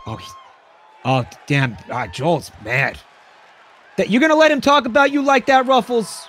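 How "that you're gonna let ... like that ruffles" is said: raised-pitch, mock-outraged goad. Commentator goading Ruffles to fight back, goading. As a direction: egg the fighter on in a higher voice. Commentator goads the chip wrestler.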